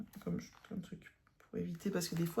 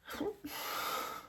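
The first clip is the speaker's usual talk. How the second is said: high, wheezy giggling fit; helpless laugh; 10 semitones higher, 7 dB quieter than usual. Real, not acted. Laughing at her own English accent, breathy high giggles. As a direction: crack up at yourself, mostly breath.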